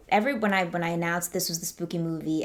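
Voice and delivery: ordinary delivery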